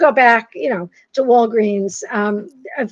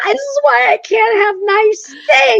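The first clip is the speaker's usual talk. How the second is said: higher, louder, half-crying mock lament; anguished mock wail; 11 semitones higher, 4 dB louder than usual. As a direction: wail it like a hammed-up heartbreak. Retelling humiliation at store counter.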